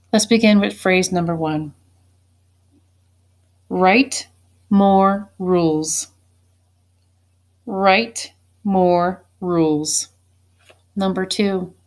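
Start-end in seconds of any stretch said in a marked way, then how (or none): 3.7-6.1 slow dictation. Shown as a demonstration. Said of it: "dictating a phrase slowly". 7.7-10.1 slow dictation. Shown as a demonstration. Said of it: "dictating a phrase slowly".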